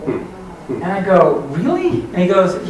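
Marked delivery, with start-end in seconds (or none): 1.5-2.0 high-pitched incredulous "really?"; retold disbelief, pitch leaping up; surprised. Dad questioning son's sneaker resale idea, surprised.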